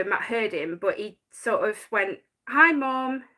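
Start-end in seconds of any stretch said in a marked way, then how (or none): none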